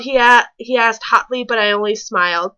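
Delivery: ordinary delivery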